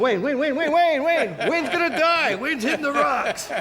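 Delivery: high, loud, excited chatter; gossiping crowd. Retold or imitated. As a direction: pass the news along breathlessly. Beachgoers spreading the news.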